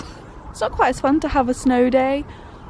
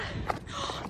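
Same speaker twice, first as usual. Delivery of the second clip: delighted gasp; 15 dB quieter than usual. Real, not acted. Spots a cat.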